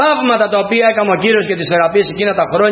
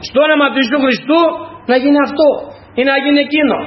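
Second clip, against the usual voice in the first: higher-pitched, forceful declaration; acted prayer shout; 6 semitones higher than usual. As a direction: proclaim it as if commanding in prayer. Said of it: "έρχεται δύναμη από τον Κύριο και φωνάζουμε".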